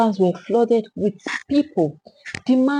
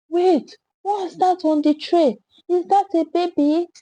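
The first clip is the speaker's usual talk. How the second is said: alarmed; 7 semitones higher than usual. A narrator's reading. Someone in crowd alarmed.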